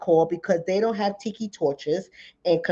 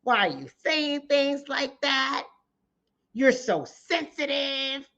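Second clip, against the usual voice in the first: higher, mocking tone of a dismissive critic; 6 semitones higher, 4 dB quieter than usual. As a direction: lightly mimic someone brushing it off as oversensitive. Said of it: "people in our own community that say".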